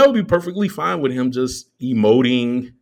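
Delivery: normal talking voice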